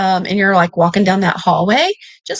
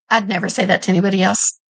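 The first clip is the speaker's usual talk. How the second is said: ordinary talk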